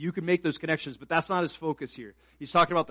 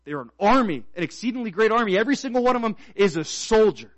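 loud, raised preaching voice; full-force preaching; 7 semitones higher, 8 dB louder than usual. Real, not acted. Preacher rousing the church as an army of soldiers, full-force preaching. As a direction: ring out each key word louder and higher.

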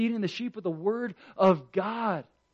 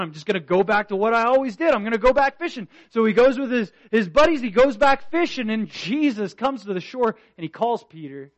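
loud, higher preacher's cry; preaching at full force; 5 semitones higher, 9 dB louder, faster than usual. Acted. Preacher telling the congregation Peter's return to fishing, loud. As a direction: voice Peter's resignation then drive the story on.

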